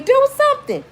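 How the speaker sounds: very high, loud panicked scream; desperate retold scream; screaming plea. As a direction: scream it at your sister. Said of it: "I'm screaming".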